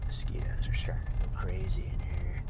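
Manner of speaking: hushed whisper aside. Real, not acted. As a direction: whisper it under your breath. Unvoiced aside.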